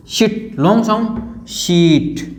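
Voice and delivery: "शिट" short, then "शीट" with a long slow ee; short vs long vowel. Shown as a demonstration. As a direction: contrast clipped "शिट" with stretched "शीट". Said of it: "short sound की बात करूं". ("शिट" छोटा, "शीट" लंबा खींचकर)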